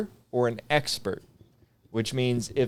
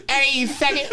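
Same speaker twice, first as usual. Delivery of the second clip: taunting call; 13 semitones higher, 3 dB louder than usual. Retold or imitated. Taunting the sergeant from the line.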